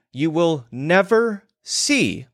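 NEVER stretched out slowly and hit hard; never in all caps. Retold or imitated. Reading the website copy, stressing the all-caps never. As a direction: lean on never, slow and emphatic. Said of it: "never all caps".

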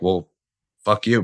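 ordinary talk

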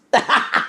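quick high unvoiced laugh; breathy laugh. Real, not acted. Laughing after telling the girl story. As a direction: let out a short airy laugh.

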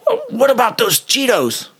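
high, whiny suspect voice; squeaky mock-indignant question; playing the irritated prisoner; mimicking the suspect. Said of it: "He goes".

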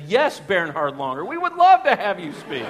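loud, high comic eagerness; hammed-up sudden enthusiasm, pitch climbing; gushing welcome. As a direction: build to an over-eager fan's yes. Acts his sudden eager about-face.

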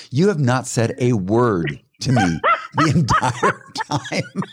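retold exasperation breaking into laughter; exasperated, laughing. Wife to silent daughter after the trip, retold exasperated through laughter. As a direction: drag out the complaint, cracking up toward the end.

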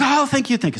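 high, hurried imitation of relief; mock gushing thanks. Bride thanking pastor for agreeing, mock gushing relief. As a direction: gush it out in a high put-on voice.

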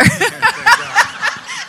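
high bright joyful laugh; delighted laugh. Real, not acted. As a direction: laugh out the happy ending. Ends testimony with a laugh.

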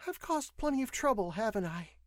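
high female voice, rueful question; contrite young woman. A narrator's reading. Patient owns up to causing trouble, rueful. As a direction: admit it in a high-pitched voice. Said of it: "she asked aloud".

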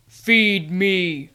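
loud, booming command; commanding creature voice. A narrator's reading. Sewer djinn demands food of Stacy, commanding boom. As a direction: order it like a hungry spirit. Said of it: "the voice commanded".

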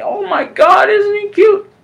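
very high-pitched adoring squeal; gushing cooing. Real, not acted. Speaker fussing over a beloved little guy, gushing squeal.

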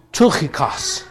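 "Tychikos" said slowly, the Greek way; Greek pronunciation. Shown as a demonstration. Preacher showing the congregation the Greek, Greek pronunciation of "Tychikos". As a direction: say "Tychikos" slowly with Greek sounds, "TOO-khee-kos". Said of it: "pronounce it in the Greek".

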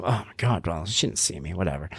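quiet relieved mutter; grumbling to myself. Retold or imitated. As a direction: grumble under your breath in the car. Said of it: "grumbling to myself".

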